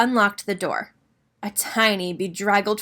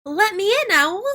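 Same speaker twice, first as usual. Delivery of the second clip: high, louder call to be let in; crying out; 10 semitones higher, 4 dB louder than usual. Acted. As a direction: call through the door, pleading. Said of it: "cried Rabbit".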